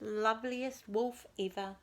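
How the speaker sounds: usual speaking voice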